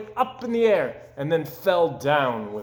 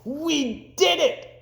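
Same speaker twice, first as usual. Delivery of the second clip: cried out; 13 semitones higher, much slower than usual. Acted. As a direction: cheer it out. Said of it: "cried Toad".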